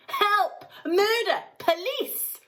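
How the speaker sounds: high, loud cries for help; mother shouting for help as her son vanishes; frantic shout. A narrator's reading. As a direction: shriek for rescue. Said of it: "shouted Missus Gloop".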